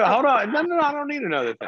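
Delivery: high, fast no no no; rapid exclaiming refusal. Real, not acted. Host refusing caller's next complaint, fast and high. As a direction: cut her off, laughing exasperation.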